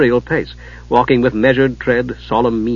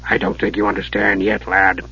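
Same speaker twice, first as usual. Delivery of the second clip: grimly; 5 semitones lower, 3 dB quieter than usual. A narrator's reading. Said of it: "Dugan said grimly".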